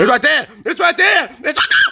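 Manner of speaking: high-pitched frantic shouting. Real, not acted. Frantic pointing out in game.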